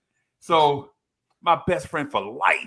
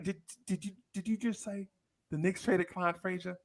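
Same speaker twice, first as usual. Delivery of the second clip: much softer, higher, stammering in shock; mock stunned kid; 4 semitones higher, 9 dB quieter than usual. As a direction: whisper it as if the world ended.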